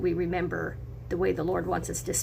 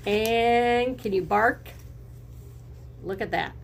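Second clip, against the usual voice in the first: ordinary delivery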